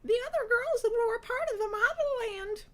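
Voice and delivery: very high, loud put-on character voice; Shiraz. A narrator's reading. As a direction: squeal it in theatrical falsetto. Shiraz speaking in her put-on voice.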